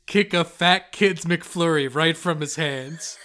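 ordinary delivery

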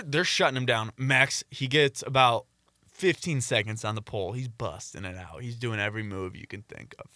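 usual speaking voice

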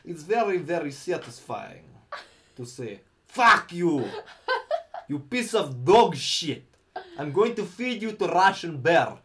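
Russian accent. Shown as a demonstration. Showing co-host his satisfying Russian insults, Russian accent. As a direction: boom out mock threats like a Russian. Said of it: "I do a Russian accent pretty well".

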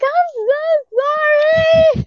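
yelling sorry the moment he walks up; panicked shout. Retold or imitated. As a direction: blurt a long, high, shouted apology. Shouted apology when he approaches.

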